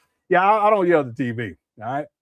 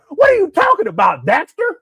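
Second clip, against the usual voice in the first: high, loud mock shout as Umar; hammed-up rant imitating Umar; mock furious shout; crashing out like Umar; 12 semitones higher, 8 dB louder than usual. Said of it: "He start crashing out like Umar".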